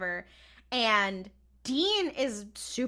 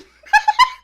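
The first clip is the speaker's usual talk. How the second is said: very high, loud helpless laugh; real shrieking laugh; burst of laughter; 16 dB louder than usual. Real, not acted. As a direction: crack up at the line. Laughing at "a car fell on him.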